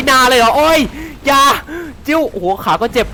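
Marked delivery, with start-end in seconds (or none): 0.1-1.4 shouted disbelief, a bit higher; startled yell. Real, not acted. Player yelling at the game as he is tossed around, startled yell. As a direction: yell it at the screen in shock.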